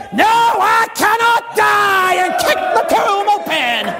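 high, drawn-out preacher's cry; triumphant shout. Acted. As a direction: bellow the victory from the pulpit. Triumphant resurrection climax.

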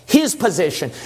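ordinary delivery

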